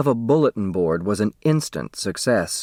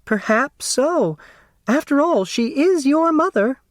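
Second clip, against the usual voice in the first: timid Flora. Acted. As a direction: answer sweetly and hesitantly. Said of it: "Flora Bomback's answer was".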